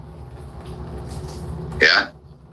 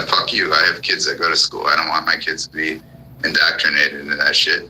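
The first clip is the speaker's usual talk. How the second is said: ordinary talk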